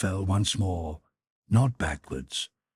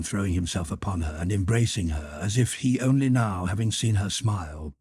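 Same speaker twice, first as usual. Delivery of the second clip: ordinary delivery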